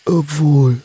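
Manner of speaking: ordinary delivery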